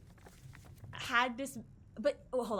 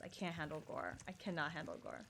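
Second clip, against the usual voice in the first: ordinary delivery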